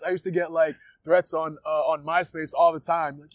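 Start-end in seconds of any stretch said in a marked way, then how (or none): none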